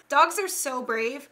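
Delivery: ordinary delivery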